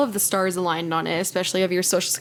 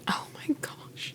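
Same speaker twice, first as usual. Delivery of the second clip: shocked whisper; 13 dB quieter, slower than usual. Real, not acted. Listener hears about the cocaine, whispers in shock. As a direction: breathe it out in a slow whisper.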